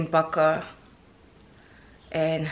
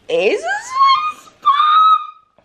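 excited shout; 7 dB louder, much slower than usual. Real, not acted. Shouts excitedly at new shoes.

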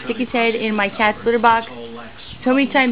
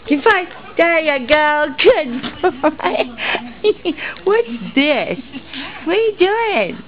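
high sing-song puppy talk; 7 semitones higher than usual. Real, not acted. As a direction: coo at the dog, pitch way up. Cooing at the puppy on camera.

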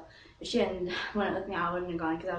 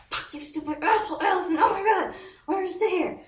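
high, loud exasperated rant; imitated high-pitched fuming; frustrated outburst; 10 semitones higher, 7 dB louder than usual. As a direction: lash out in acted frustration. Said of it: "I was so frustrated".

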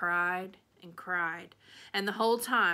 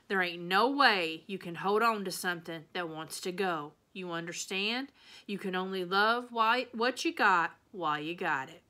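ordinary delivery

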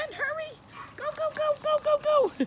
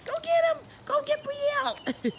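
cheering on. Real, not acted. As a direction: egg the puppies on, squealing. Cheering dogs on.

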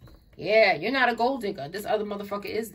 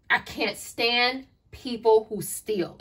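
"I can't stand people who steal" drawn out slowly, word by word; emphatic disgust; much slower than usual. Real, not acted. Tarot reader declaring the pet peeve, "I can't stand people who steal" slow and emphatic.

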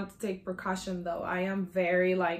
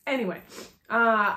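normal talking voice

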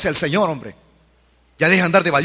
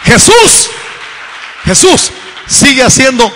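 preacher's exclamation; 6 semitones higher than usual. Real, not acted. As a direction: shout it out in separated bursts. Preacher calls out to the congregation.

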